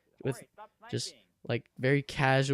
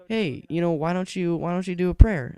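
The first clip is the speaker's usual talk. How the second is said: teacher; 5 semitones higher, 5 dB louder than usual. Retold or imitated. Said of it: "He's like".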